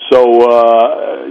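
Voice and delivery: ordinary delivery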